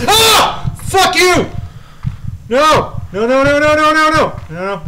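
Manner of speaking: very high, loud panicked screaming; startled scream. Real, not acted. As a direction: shriek and swear from a jump scare. Jump scare in game.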